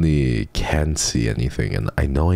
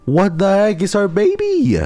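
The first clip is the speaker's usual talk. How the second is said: much higher, louder, cutesy squeal; 7 dB louder than usual. Real, not acted. Squeals over the cat.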